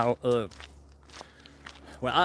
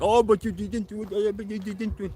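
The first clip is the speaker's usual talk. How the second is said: higher, fussy know-it-all whine; nitpicky expert voice; 7 semitones higher than usual. Retold or imitated. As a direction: mimic a picky critic correcting you. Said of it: "'cause they're gonna be like".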